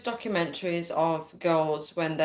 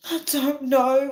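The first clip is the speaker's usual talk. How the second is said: high, drawn-out plea to a friend; retold desperate voice, slow and pitched up; 8 semitones higher, 4 dB louder, slower than usual. Woman phoning her friend for help, desperate. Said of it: "my desperate voice on the phone".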